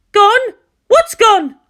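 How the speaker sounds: high, loud and arch; arch question. Acted. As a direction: challenge the word gone. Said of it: "she asked archly".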